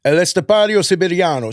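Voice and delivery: high, tapped-r Italian flourish; Italian accent. Shown as a demonstration. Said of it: "with an Italian accent now".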